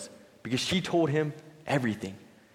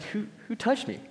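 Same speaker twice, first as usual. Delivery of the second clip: high, puzzled question; bewildered Jesus turning around; confused; 9 semitones higher, 5 dB quieter than usual. Retold or imitated. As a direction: ask it baffled, searching the crowd. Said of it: "He, he was confused".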